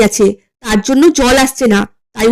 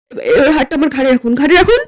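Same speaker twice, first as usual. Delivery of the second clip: ordinary talk, nothing marked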